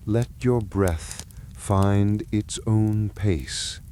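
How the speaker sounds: ordinary delivery, nothing marked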